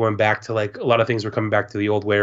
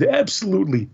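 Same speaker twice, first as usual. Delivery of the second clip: higher-pitched, obliging reply; Dan; 9 semitones higher than usual. Retold or imitated. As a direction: imitate a kindly yes to a child. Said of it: "And then he said".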